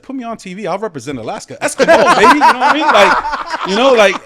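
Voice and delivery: loud, high laughing shout; excited and laughing. Real, not acted. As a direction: burst out laughing with eager excitement. Excited yes to TV offer.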